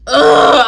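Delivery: loud, drawn-out ugh of frustration; big exasperated ugh; exasperated groan. Real, not acted. Fed up he lives elsewhere.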